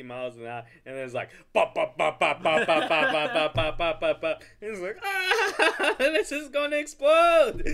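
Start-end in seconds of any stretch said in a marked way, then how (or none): 1.5-4.4 loud, rapid string of pops; imitated banging. Imitating the wobbling wheels' noise. 5.0-7.7 very high, loud hammed-up terror; mock panicked scream. Acting out panic during death wobble.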